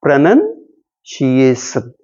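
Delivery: slow "प्रोनंसिएशन", broken after pro-NUN; correct pronunciation. Shown as a demonstration. Teacher modelling the right form for students, slow, split-up "प्रोनंसिएशन". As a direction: say "प्रोनंसिएशन" slowly in parts, pro-nun, see-ay-shun. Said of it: "लेकिन हम इसे".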